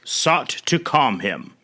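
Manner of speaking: usual speaking voice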